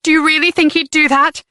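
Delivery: high, fragile hope; hopeful. A narrator's reading. Leanne asking Jenny about the father, almost hopeful. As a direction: reach for a hopeful answer. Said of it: "she almost sounded hopeful".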